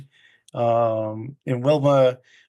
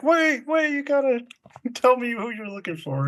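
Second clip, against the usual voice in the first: mock doorman; 9 semitones higher than usual. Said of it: "He's like".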